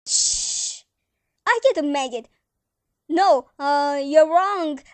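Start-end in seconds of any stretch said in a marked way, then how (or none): 0.0-0.8 unvoiced sharp shh; hushing. Nephew hushing the aunts on the phone, unvoiced shh. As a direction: hush them fast without voice.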